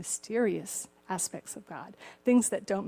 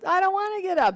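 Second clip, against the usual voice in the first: put-on higher, louder whining kid voice; whiny son; 10 semitones higher, 7 dB louder, faster than usual. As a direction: whine like a kid who won't get out of bed. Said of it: "the son goes".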